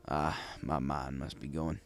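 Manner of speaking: plain reading voice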